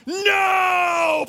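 high, loud, long-held "No"; gleeful refusal shout. Retold or imitated. Dad answering his soaked son, drawn-out shout. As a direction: bellow it in mock triumph.

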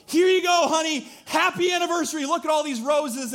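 hammed-up eager husband presenting roses; mock chipper husband. Husband hands wife roses on anniversary, hammed-up high voice. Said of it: "And I come in and I go".

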